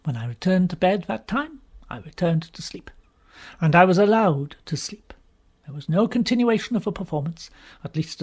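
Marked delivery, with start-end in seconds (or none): none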